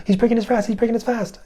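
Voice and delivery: put-on high, excited elf-girl voice; a little elf girl squealing the news. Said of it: "this elf girl".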